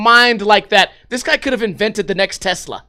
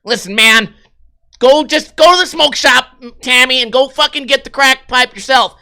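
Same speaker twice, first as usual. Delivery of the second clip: put-on mildly annoyed boyfriend; 5 semitones higher, 4 dB louder than usual.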